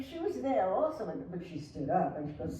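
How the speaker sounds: ordinary delivery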